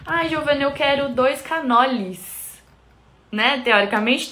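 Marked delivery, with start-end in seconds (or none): 0.1-2.6 lightly voiced customer, higher, drawing out "canoles"; customer ordering. Customer asking Giovana for two, higher-pitched imitated customer voice. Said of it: "cliente falando assim".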